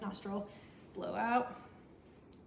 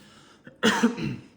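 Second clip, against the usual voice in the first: one loud cough on request; forced cough; 3 semitones lower, 12 dB louder than usual. Shown as a demonstration. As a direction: cough once for the doctor. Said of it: "cough for me".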